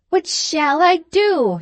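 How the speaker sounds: high, slow, drawn-out wail; mild tearful distress. A narrator's reading. Spinster aunt asks for help, bursting into tears, mildly wailing. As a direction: lament helplessly, stretching the words out. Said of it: "bursting into tears".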